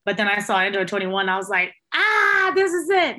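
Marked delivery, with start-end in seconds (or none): 1.9-3.2 high, bright-eyed "this is it"; excited realization. Retold or imitated. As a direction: pitch it way up like you found the one. Sees Android 21, excited.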